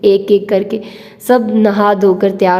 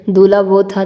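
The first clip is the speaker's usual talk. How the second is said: plain reading voice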